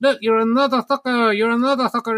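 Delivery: high, cheeky puppet voice; squeaky ventriloquist dummy. Pretend. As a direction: sneer like a rude dummy. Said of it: "my terrifying ventriloquist dummy".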